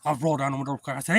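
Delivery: usual speaking voice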